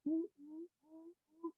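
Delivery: high, very soft "ooh"s; tiny high cooing, imitating giraffes; giraffe noises. Giraffes in the hallway reacting, soft giraffe noises. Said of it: "all the giraffes like".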